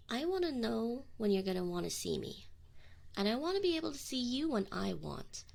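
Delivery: normal talking voice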